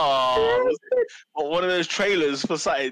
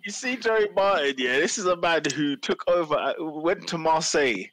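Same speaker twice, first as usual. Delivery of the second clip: ordinary talk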